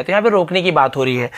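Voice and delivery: normal talking voice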